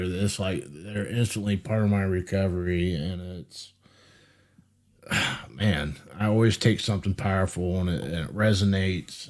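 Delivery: low, soft, emotional voice; moved near tears. Real, not acted. Host moved by his guest's story, low and near tears. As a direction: trail down quietly, sighing, holding back tears. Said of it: "I'm about to start crying".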